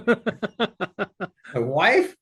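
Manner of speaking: punchline said through a laugh; giggling as he lands the joke; amused two-word tag, laughing. Real, not acted.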